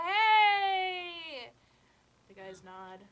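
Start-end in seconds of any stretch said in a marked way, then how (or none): 0.1-1.5 loud excited hey; shouted greeting. Acted. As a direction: call out across the room. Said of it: "they go".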